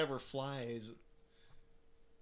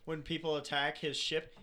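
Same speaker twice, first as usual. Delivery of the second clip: ordinary delivery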